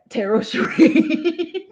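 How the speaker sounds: slow, loud words dissolving into laughter; high, drawn-out cackling delivery; laughing. Real, not acted. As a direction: laugh through the name. Laughing at a viewer's name.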